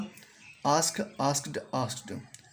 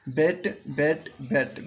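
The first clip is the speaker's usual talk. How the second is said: slow pronunciation demo; much slower than usual. Shown as a demonstration. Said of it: "मैं इसको pronunciation करते जाऊंगा".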